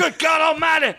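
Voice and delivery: high shouted commentator cry; Jim Ross. Retold or imitated. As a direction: yell it like a ringside announcer. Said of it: "I was doing a Jim Ross".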